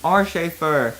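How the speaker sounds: normal talking voice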